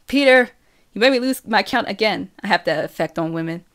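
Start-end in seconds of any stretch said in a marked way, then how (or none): 0.1-2.3 louder, higher-pitched complaint; exasperated scolding. A narrator's reading. Girl counting flour scoops, interrupted by Peter, loudly exasperated. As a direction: call his name sharply, then complain. Scolds him for making her miscount.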